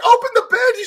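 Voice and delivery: high, loud command shouted in a panic; impatient yell. Retold or imitated. Pushing his wife to open the bandage, panicked yell. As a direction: hurry her up with a yell. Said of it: "I'm like".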